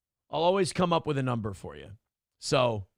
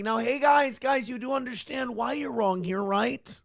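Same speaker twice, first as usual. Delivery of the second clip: nerdy Rubio impression; 6 semitones higher than usual. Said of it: "Marco Rubio's like".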